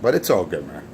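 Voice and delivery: normal talking voice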